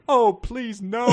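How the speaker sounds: ordinary talk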